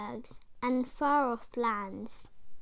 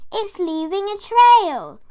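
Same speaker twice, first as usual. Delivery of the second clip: put-on higher, loud, amazed kids; excited children; 7 semitones higher, 11 dB louder than usual. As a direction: tell everyone what they see. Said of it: "say the children".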